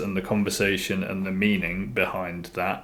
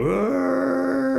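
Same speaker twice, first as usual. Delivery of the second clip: high falling yell; cartoonish scream of a falling man. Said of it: "ready to fall down and go".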